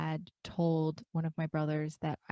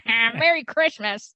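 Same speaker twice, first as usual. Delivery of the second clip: slow, higher, louder smug greeting; gloating brother; 6 semitones higher, 11 dB louder, slower than usual. Retold or imitated. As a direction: drag it out like a triumphant prankster. Brother gloating over prank.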